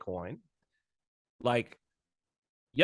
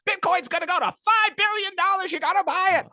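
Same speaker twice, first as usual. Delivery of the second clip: high, far-off mocking hype voice; shrill crypto shill yelling buy; Max Keiser impression. Mocking Max Keiser to a podcast host, high pushy hype. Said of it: "Max Keiser being like".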